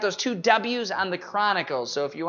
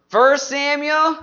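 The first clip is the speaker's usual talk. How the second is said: higher, held-out cue; call-and-response prompt; 6 semitones higher, 4 dB louder, slower than usual. Shown as a demonstration. Teacher says the book for the class to answer, raised prompting call. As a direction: call it out and leave it open. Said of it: "I'll say the book".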